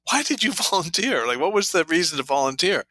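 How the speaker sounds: can't believe she volunteered, chuckling; amused disbelief. Retold or imitated. Coach wondering to himself, laughing in disbelief. Inner thought at odd volunteer.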